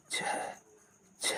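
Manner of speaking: breath-only consonant demo; voiceless, all air; whispered. Shown as a demonstration. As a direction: whisper each sound slowly apart. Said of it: "कानाफूसी की आवाज़ में बोल रहे हैं".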